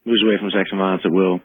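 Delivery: ordinary talk, nothing marked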